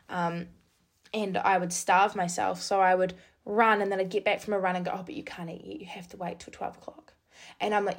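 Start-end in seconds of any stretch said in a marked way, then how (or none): 4.9-7.1 near-whispered inner voice, dropping almost to breath; inner voice, hushed. Retold or imitated. Runner's eating-disorder voice hushes her after a run, whispered. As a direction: let the sick inner voice hiss it softly.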